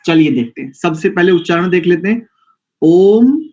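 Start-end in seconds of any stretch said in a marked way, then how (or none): 2.8-3.4 ओम drawn out long, rising and louder; ओम stretched into pluta length; prolonged om. Shown as a demonstration. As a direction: hold ओम like a chant. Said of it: "ओम शब्द का उच्चारण प्लुत में करें".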